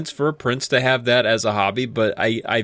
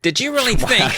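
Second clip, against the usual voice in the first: attempted British accent; 10 semitones higher than usual. Caped man confronting runaway kids, in a put-on British accent. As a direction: ham up a British accent, John Cleese style. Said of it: "I gotta work up a British accent".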